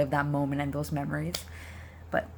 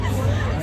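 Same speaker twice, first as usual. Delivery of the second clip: high laugh; 7 dB louder than usual. Real, not acted. Friend's joke makes her laugh.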